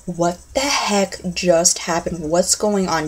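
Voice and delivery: slow, softened, dazed disbelief; mock stunned confusion. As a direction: act frozen in bewilderment, drawing it out. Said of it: "the confusion".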